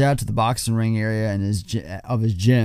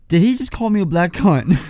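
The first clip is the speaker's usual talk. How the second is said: higher-pitched mock indignation, half laughing; Ernie imitation; 6 semitones higher than usual. Host quoting Ernie asking Coach, mock indignant. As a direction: act out the affronted question in a higher voice. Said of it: "looks at Coach and he goes".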